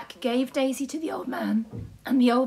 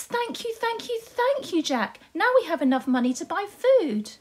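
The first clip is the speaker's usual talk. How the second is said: much higher, delighted thanks; pleased mum; 7 semitones higher than usual. Acted. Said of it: "Jack's mummy was so pleased".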